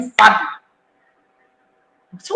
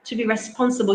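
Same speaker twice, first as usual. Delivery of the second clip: ordinary talk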